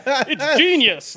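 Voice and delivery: high, loud, drawn-out laughing gush; mock awe. Host mimics praise of a Nolan trailer, mock gushing. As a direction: gush in exaggerated admiration. Voicing reaction to a silly trailer.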